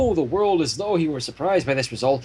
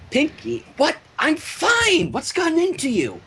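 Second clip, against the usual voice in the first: higher flustered protest; startled Twilight; 10 semitones higher than usual. A narrator's reading. Twilight protests as Pinkie grabs her face, startled. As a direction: push back, startled and confused.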